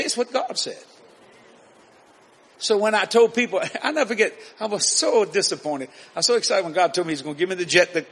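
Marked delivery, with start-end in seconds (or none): none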